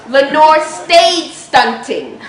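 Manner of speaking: Lenore stayed stunting, each word slow and weighty; slow punchline. Real, not acted. Punchline borrowed from [name].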